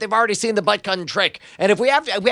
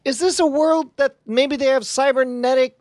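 high, halting, plaintive question; tentative appeal; 5 semitones higher than usual. Acted. Player asks his inner operating system, plaintive. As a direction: ask haltingly in a raised voice. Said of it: "I quietly in my head".

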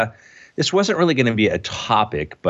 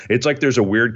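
normal talking voice

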